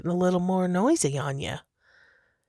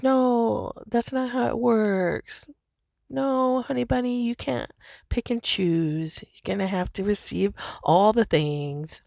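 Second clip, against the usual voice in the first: higher, doting voice; affectionate angels; 6 semitones higher than usual. Retold or imitated. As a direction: gently tease a cutie, higher. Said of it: "it's such affection".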